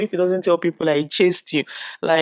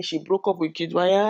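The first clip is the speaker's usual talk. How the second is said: ordinary talk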